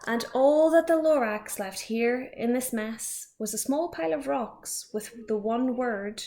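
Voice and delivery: ordinary delivery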